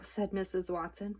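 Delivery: ordinary delivery, nothing marked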